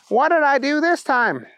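high-pitched whine of a question; exasperated Booger. A narrator's reading. Booger jumping out after the bunny yells, high complaint. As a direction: pitch it up, put-upon.

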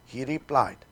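ordinary delivery

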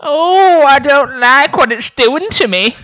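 high, loud theatrical unease; hammed-up dismay. Art critic smelling the piece, hammed-up high dismay. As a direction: overplay the distress, pitched right up. Replayed line, new reaction on request.

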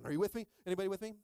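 normal talking voice

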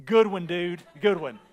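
loud, unimpressed mock praise; acted-out scoffing at a lame insult; sarcastic comeback. Sarcastic comeback to a weak taunt.

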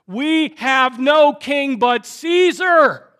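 high, loud, slow shout; horrible declaration. Acted. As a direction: thunder it slowly, word by word. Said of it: "with these horrible words".

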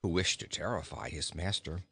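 usual speaking voice

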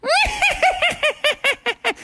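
long, very high cackle. Acted. Laughing outside Froggy's window grows, high witch cackle. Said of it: "But the laughing got louder".

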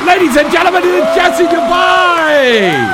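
high, loud, slow showman shout; hype announcer. Acted. Radio host introducing caller to imagined crowd, booming announcer shout. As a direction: announce her like a boxing champ entering.

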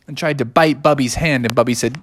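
usual speaking voice

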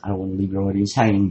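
normal talking voice

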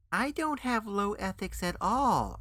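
high, slow, indignant protest; insistent. A narrator's reading. Guest defends his ethics to the men, insistent and high. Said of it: "I insisted".

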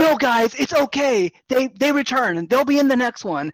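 high, loud mock lecture to the room; know-it-all guy. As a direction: play the annoying fan explaining the plot. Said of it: "He's like".

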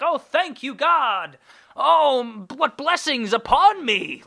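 hammed-up pious gratitude; mock grateful gushing. Sarcastic thanks to the rich killer, high gushing. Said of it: "I'm not gonna be like".

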